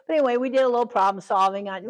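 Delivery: ordinary talk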